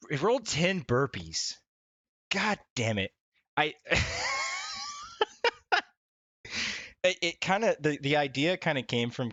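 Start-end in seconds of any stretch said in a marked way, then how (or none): 3.8-5.8 high pained laughing shriek; laughing cry. Real, not acted. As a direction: squeal out a laugh of despair. Rolled ten burpees, cries out.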